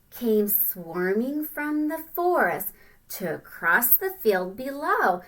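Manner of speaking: slow, expressive read-aloud; storybook reading. Acted. Teacher reading the ant book to children, slow and expressive. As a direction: read it slowly like a picture book.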